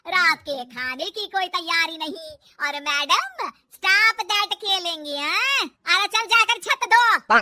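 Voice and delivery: slow, loud, sarcastic telling-off; loud exasperated mother-in-law; angry scolding. Acted. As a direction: drag out the words, then bark the order. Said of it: "गुस्सा आ जाता है".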